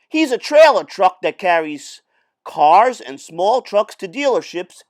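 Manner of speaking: loud, animated read-aloud voice; lively story reading. Acted. Teacher reading a truck story to students, animated. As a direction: read it big for the kids.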